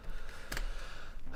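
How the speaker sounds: long, soft, breathy sigh; weary sigh. Real, not acted. Mapmaker after control Z failed, long sigh. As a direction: let out a long, quiet breath.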